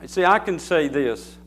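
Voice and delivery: normal talking voice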